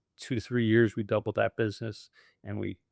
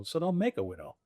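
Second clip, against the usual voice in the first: higher, softer quip; retort; 6 semitones higher, 7 dB quieter than usual. Retold or imitated. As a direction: toss it back with a wry punch. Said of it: "I go".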